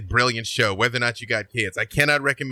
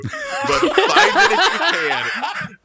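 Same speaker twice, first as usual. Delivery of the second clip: laughing; 6 dB louder, much slower than usual. Real, not acted. Laughs recalling funny episode.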